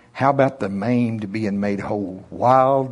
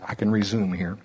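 ordinary talk, nothing marked

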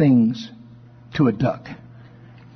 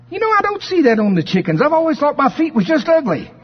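higher, fast and puzzled; wondering duckling; 11 semitones higher, faster than usual. Acted. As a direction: realise it aloud like a naive chick. Chuck realizes about his feet.

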